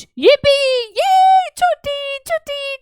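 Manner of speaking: high, drawn-out jubilant squeal; happy children cheering. Acted. Children celebrating a day without lectures, gleeful high cheer. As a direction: cheer it out like kids let off school. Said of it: "बच्चे खुश".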